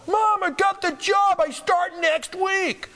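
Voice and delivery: excited news to mom. Retold or imitated. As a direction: blurt the good news to mom. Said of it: "Called my mom".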